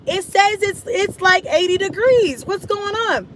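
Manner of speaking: high, baffled disbelief. Retold or imitated. Reading the weather app, baffled by the delay. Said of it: "I was like".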